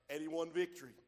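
usual speaking voice

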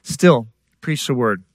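slow, weighty "Still, preach the word"; slow emphasis. Real, not acted. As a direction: lean on "Still" slowly. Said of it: "slow emphatic conclusion".

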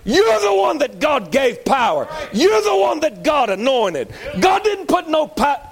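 high, forceful preacher's cry; fervent preaching shout. Real, not acted. Preacher telling the congregation who holds God's power, fervent shout.

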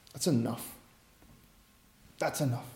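very soft, low and final; Jesus quietly ending the fight; hushed firm stop. Acted. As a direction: close the matter gently but firmly. Jesus stops them.

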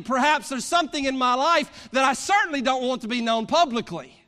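fervent pulpit cadence, driving toward confession; preaching at full force. Acted. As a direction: preach it out to the congregation, pressing each point.

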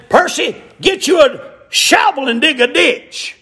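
high, ringing order in a retold story; voicing God's insistent command; commanding call. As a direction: call him out by name and order him. Acted command from God to Percy.

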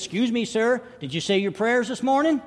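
put-on high-pitched little boy's question; insistent boy. Boy asks seatmate about prayers, insistent and high.

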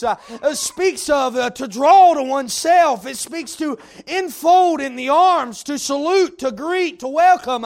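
rising, rhythmic pulpit cadence with punched words; preaching at full force. Acted. Preacher exhorting the congregation, full-force preaching. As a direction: preach it hard to the room.

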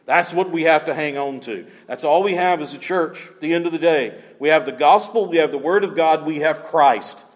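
ordinary talk

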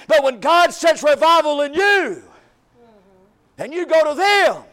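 preaching at full force, loud and rising. Acted. Pastor urging the church toward revival, loud preaching. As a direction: build the sermon to a shouting peak.

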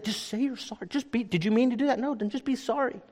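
high, soft, exasperated parent voice; pleading with the kids, worn out and mock-exasperated; frustrated parent. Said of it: "I get so frustrated with my kids".

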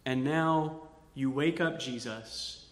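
ordinary talk

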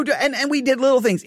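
usual speaking voice

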